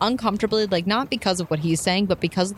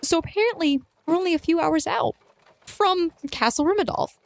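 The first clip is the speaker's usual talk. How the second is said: much higher, anxious voice; panicked; 10 semitones higher than usual. Acted. Snow warns her companions they are nearly there, panicked. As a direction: break the news in rising alarm. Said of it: "Snow is kind of looking panicked".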